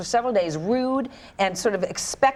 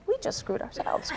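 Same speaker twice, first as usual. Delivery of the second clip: whispered aside, softer; 8 semitones higher, 8 dB quieter than usual. Retold or imitated. Mother retells whispering to husband after cancelling vacation, whispered. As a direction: breathe it like a guilty secret.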